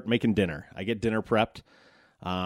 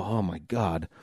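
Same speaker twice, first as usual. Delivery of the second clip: weary dread; 5 semitones lower, 6 dB quieter, slower than usual. Retold or imitated. Parent dreading child's early request.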